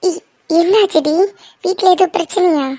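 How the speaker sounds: normal talking voice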